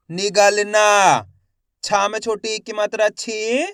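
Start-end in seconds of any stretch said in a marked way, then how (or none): 0.1-1.2 slow blended demo. Shown as a demonstration. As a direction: draw the word out, syllable by syllable joined. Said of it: "शिक्षक शब्द जोड़कर पढ़ाता है". (धीरे-धीरे खींचकर पढ़ना)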